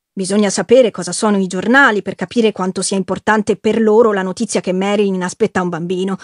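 ordinary delivery, nothing marked

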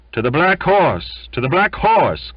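loud, high rallying call; crying out. Acted. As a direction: call the crew off to the pub. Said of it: "cried some".

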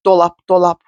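"dolap" over and over on one even note; monotone, evenly spaced repeats of "dolap"; meaningless word repetition. Shown as a demonstration. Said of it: "sürekli sanki şey diyorum böyle".

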